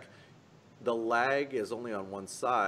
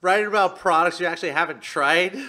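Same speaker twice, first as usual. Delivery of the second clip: mock accusation; 10 semitones higher, 15 dB louder, much faster than usual. Teasing accusation of paid shilling.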